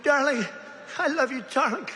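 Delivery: a hammed-up proud mother calling out; mild mock yell. Mother cheering her daughter at the lift, mild mock yell. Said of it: "the mother's screaming".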